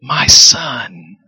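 the father whispers lovingly; a loving father whispering to his son; tender whisper. Acted. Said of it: "And he says".

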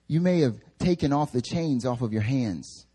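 usual speaking voice

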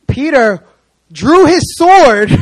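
loud, slow, rising proclamation; dramatic reveal. Acted. Preacher building up Peter drawing his sword, slow and loud. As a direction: boom it out like a big reveal.

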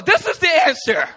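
high, loud eureka; thrilled revelation; excited realization. Retold or imitated. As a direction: shout out the discovery. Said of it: "And so I was".

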